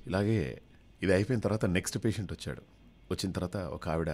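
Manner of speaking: usual speaking voice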